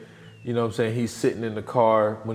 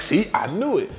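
higher-pitched mock exasperation; acted-out squabbling retort; retold argument; 7 semitones higher than usual. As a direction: imitate an exasperated friend arguing back. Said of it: "sitting there arguing".

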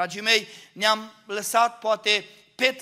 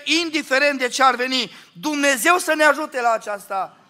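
higher, louder pulpit appeal; preaching; 3 semitones higher, 5 dB louder than usual. Acted. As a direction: build the appeal to the pastors. Preacher exhorting the congregation.

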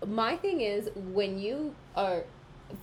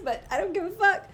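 loud defiant; 11 semitones higher, 6 dB louder than usual. Real, not acted. Defiant aside to male listeners.

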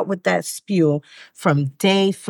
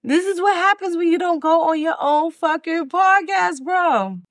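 laughing shout; 9 semitones higher than usual. Real, not acted. Host yelling at herself for forgetting her mantra, laughing shout. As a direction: shout it out in disbelief through laughter.

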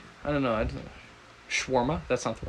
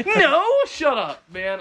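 exasperated yell; 12 semitones higher, 4 dB louder, slower than usual. Real, not acted.

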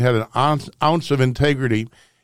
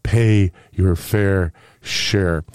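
slow, drawn-out mock whisper of an old politician; mock Biden whisper; 5 semitones lower, much slower than usual. Radio host mocking Joe Biden at the microphone, slow drawn-out mock whisper. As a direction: stretch each word out slowly, mocking a hushed plea. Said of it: "he whispers. He says".

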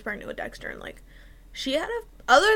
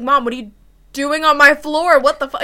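loud startled yell, louder on doing on my floor; retold shocked yell; 3 semitones higher, 11 dB louder than usual. Teen yelling at mom whose water broke, shocked shout. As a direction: yell it at mom, caught off guard.

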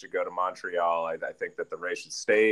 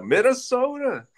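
"Minnesota" sung much higher and slow, vowels stretched; hammed-up Minnesotan accent; 4 dB louder than usual. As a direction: stretch out "Minnesota" like a Minnesotan, high and sing-song. Drawn-out mock Minnesota pronunciation.

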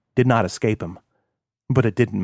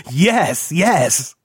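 higher, giggling answer; laughing yes; 5 semitones higher than usual. Acted. Kilroy answering Dania with a grinning laugh. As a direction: laugh through the words.

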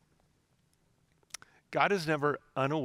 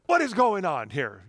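louder, higher frustrated outburst; exasperated parent; 4 semitones higher, 5 dB louder than usual. Retold or imitated. Father walking out to noisy kids, exasperated. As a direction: re-enact a dad at the end of his rope. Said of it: "when you've just hit the, the, the end of the rope".

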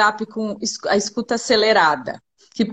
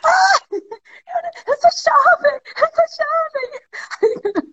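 mimicking a young guy.

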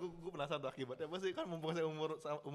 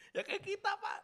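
laughing; 11 semitones higher, 7 dB louder than usual. Real, not acted. Laughing admission of laziness.

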